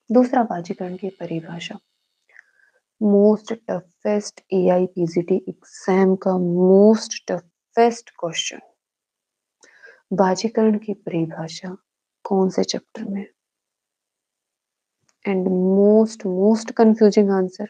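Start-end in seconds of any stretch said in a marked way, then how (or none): 3.0-8.6 very slow, stretched words; dramatic drawn-out emphasis. Real, not acted. Teacher announces the toughest exam question, very slow and emphatic. 15.2-17.7 very slow, each word stretched; dramatic drawn-out emphasis. Real, not acted. Teacher flags the most confusing answer, slow and emphatic.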